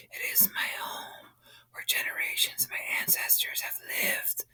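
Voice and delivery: soft unvoiced whisper, slow in places; whispered plea. A narrator's reading. Creature whispering to the sleeping man. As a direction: breathe the plea like a secret.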